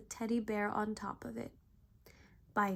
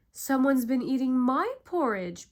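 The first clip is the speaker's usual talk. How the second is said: Papa Bear yelling; 3 semitones higher, 9 dB louder than usual. A narrator's reading. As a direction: yell it out as the big bear. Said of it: "Papa Bear yelled".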